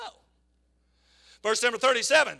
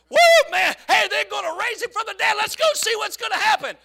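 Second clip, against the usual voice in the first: whooping, much higher and louder; excited; 7 semitones higher, 6 dB louder than usual. Retold or imitated. Church members spreading news, excited whoop. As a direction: cheer it like thrilled churchgoers.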